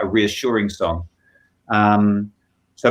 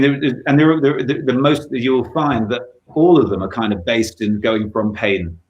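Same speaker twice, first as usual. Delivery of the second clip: ordinary talk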